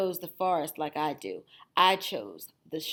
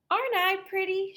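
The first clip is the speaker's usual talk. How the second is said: put-on high, fast preening girl voice; preen, quick and high; vain Little Red; 12 semitones higher, faster than usual. Vain Little Red admiring herself, high and quick.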